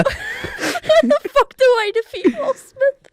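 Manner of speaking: high, loud, drawn-out laughing question; squealed amused protest; laughing exasperation. Real, not acted. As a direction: blurt it helplessly through laughter. Put on the spot, laughing helplessly.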